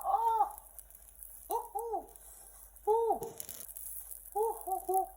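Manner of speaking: sharp high ooh's, burnt mouth; high ooh from hot food. Real, not acted. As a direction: yelp as the food burns. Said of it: "it's hot".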